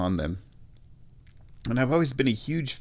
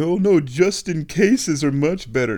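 higher, louder mock protest; objecting listener; 7 semitones higher, 5 dB louder than usual. Host mimicking a listener who prefers a rival brand, mock objecting. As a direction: put on a contrary listener's raised voice. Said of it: "I know what you're thinking".

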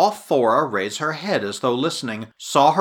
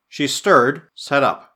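usual speaking voice

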